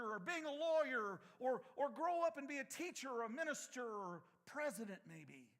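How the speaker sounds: fired-up preacher; preaching. Acted. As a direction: preach it hard to the congregation. Preaching at full force.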